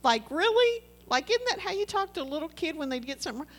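high, outraged disbelief, the second half quick; incredulous protest. Retold or imitated. To Steve in the church kitchen, a high incredulous protest. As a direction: ask how old he thinks you are, incredulous. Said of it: "I was like".

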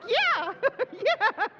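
can't hold the laugh in; laughing hard. Retold or imitated. Mother agreeing with husband, loud laughter. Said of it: "And I'm like".

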